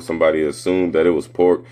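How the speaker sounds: ordinary talk, nothing marked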